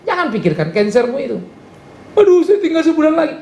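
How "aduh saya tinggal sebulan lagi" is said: high whiny voice with a laugh, mimicking a crybaby; mocking whiny complaint. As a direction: make fun of someone whining. Mocking a crying congregant. (merengek mengejek sambil tertawa)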